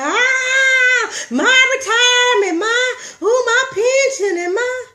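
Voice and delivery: loud, high-pitched hammed-up crying; mock wailing. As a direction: wail in exaggerated panic over the loss. Mocking panic over losing a job.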